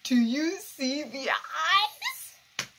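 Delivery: slow, wide-eyed awe at the screen; awed slow question. Real, not acted. Viewer awed by the eyes on screen, slow and high.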